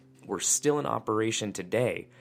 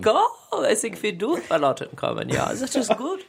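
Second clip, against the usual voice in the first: put-on campy German accent, higher; 11 semitones higher, 3 dB louder than usual.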